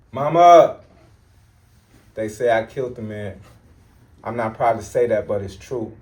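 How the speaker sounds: loud, impassioned poem opening with a cry of Mama; shouted spoken word. Acted. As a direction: belt out the first lines of the poem.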